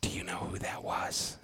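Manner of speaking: very hushed confidential whisper. Retold or imitated. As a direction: whisper it like office gossip. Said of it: "Why are you whispering?".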